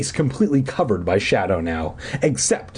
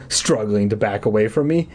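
ordinary delivery, nothing marked

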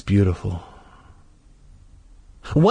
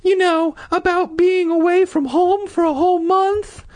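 completely different voice. Acted. Sister suggests to brother a reason for dream, high squeaky voice. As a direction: pitch it way up as the sister. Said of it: "in a completely different voice".

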